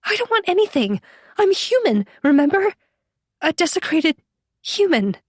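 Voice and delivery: high-pitched, anxious protest; startled Jenny. A narrator's reading. Jenny defending herself to enraged Yeshua, startled and high.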